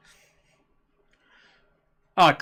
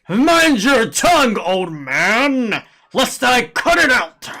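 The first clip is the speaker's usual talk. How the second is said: slow, emphatic guard's threat; threatening guard; 5 semitones higher, 4 dB louder, much slower than usual. Acted. Streamer voicing a guard threatening the old man, slow menace. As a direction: draw the warning out menacingly.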